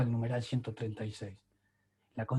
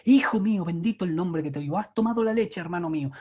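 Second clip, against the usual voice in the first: hammed-up Central American pulpit accent, higher and louder, to a child; mock Central American preacher accent; 6 semitones higher, 5 dB louder than usual. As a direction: lampoon a fake Central American preacher talking to his son. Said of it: "No, no le decís así a tu hijo". (acento centroamericano exagerado de predicador, burlón)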